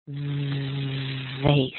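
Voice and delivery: "vase" with a long buzzing v, rhyming with face; stretched, voiced opening on "vase"; voiced V demo. Shown as a demonstration. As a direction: hold the vibrating v, then finish "vase". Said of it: "add a little voice and vibration".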